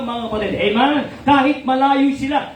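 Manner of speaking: normal talking voice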